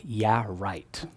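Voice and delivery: soft, low mocking "yeah, right"; scoffing. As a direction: scoff under your breath in disbelief. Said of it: "she's like".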